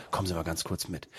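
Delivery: low, hushed word in the ear; confidential murmur. Retold or imitated. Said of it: "raunt mir ins Ohr".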